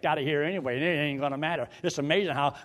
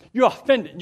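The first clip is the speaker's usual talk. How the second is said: higher, louder accusing quote; mock accusation; 9 semitones higher, 7 dB louder than usual. Preacher mimics someone accusing, loud and high. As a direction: fling the accusation back.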